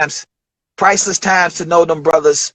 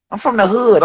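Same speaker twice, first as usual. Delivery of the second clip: ordinary talk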